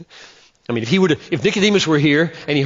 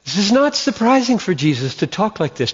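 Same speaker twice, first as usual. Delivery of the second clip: normal talking voice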